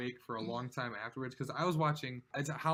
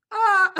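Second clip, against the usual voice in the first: sudden loud high yelp, imitated; high yelp of pain; 16 dB louder than usual. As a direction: reenact a startled cry of pain. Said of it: "it was so high pitched".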